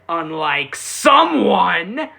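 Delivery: slow, loud, pointed jab. Real, not acted. Vlogger jabs at Toru, slow and pointed. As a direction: drag it out like a dig at him.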